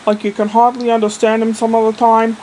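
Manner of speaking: ordinary talk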